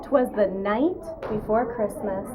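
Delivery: plain reading voice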